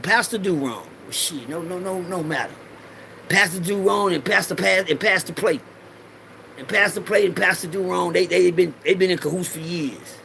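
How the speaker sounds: normal talking voice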